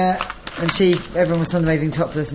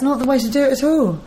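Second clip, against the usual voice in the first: indignant; 8 semitones higher, 4 dB louder than usual. Real, not acted. Indignant rant.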